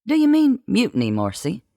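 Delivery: quick high incredulous question; startled, high-pitched query; pointed question. A narrator's reading. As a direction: pitch it up, rapid and taken aback.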